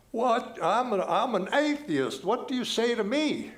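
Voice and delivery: high, loud Australian atheist challenging; heavy Australian accent. Retold or imitated. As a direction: play the blunt Australian heckler. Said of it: "put on this very heavy Australian accent".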